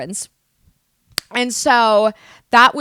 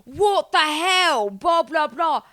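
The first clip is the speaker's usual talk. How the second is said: loud, raised mock British outrage; dramatic British accent; 6 semitones higher, 4 dB louder, slower than usual. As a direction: ham up a floored Brit's accent. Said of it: "people from the U K, the way they say anything".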